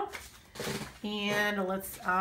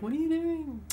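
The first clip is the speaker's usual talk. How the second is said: high-pitched pretend viewer outcry; mock scream; 8 semitones higher, 4 dB louder than usual. Crafter voicing viewers at her mistake, mock scream. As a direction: imitate a dismayed onlooker, pitched well up. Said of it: "you all were screaming at me like".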